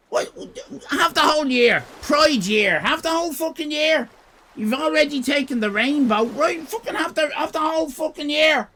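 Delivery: high, loud mock-outraged rant; hammed-up high, loud grievance. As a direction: rant it up high like a fed-up crank. Mock rant at Pride month.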